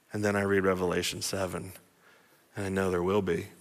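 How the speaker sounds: low, hushed certainty; hushed preacher's conviction; quiet assurance. Real, not acted. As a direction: answer the doubt softly and low. Preacher answers doubt in a hush.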